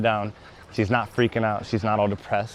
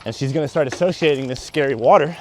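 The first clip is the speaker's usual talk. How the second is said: ordinary talk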